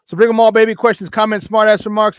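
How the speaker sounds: loud, high hype call to the chat. Real, not acted. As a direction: pump up the audience, loud and high. Hyping up the chat audience.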